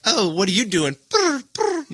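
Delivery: high cooing cat that purrs and trills; needy cat voice. Retold or imitated. Describing a cat rubbing against you, in a high cooing cat voice. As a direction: play the cat begging for attention.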